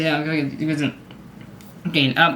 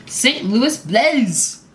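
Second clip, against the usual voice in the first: drawn-out, higher, swooping team name; hammed-up team announcement; 4 semitones higher, much slower than usual. Vlogger introducing the next hockey team, playfully drawn out.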